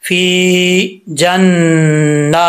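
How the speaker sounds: फी जन्ना with a held nasal nn, very slow; slow ghunna recitation. Shown as a demonstration. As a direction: hold the nn of जन्ना then stretch the aa. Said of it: "गुन्ना करने के बाद इसको लंबा करेंगे।".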